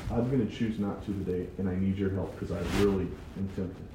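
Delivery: a quiet, sincere prayer voice; humble prayer. Shown as a demonstration. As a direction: confess quietly to God. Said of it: "and say".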